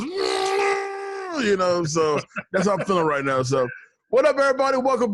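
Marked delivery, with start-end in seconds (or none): 0.0-1.5 put-on squeaky hyper-kid squeal, high and tight; high excited squeal. Imitating cousin's hyper son, high excited squeal. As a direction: squeal like an overexcited little kid. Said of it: "he goes".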